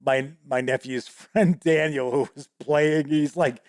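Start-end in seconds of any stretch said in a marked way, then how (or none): none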